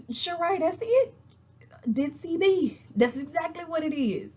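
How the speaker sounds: high-pitched, emphatic approval; excited agreement. Retold or imitated. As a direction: exclaim it up high, totally agreeing. Excited agreement with Sheree's insult.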